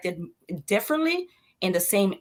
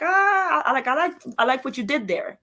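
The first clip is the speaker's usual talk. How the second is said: impressed; 6 semitones higher than usual. Retold or imitated. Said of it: "I'm like".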